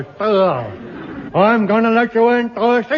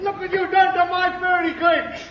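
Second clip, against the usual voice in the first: high, laughing outraged yell; mock outrage; 7 semitones higher than usual. Cake owner scolding the hunter over ruined fairy cakes, hammed-up outrage.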